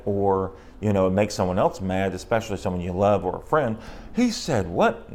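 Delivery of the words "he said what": startled, high-pitched retelling of hearing you were misquoted; shocked disbelief. Retold or imitated. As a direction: voice leaps high on what, mock shocked. Retold shocked reaction to misquote.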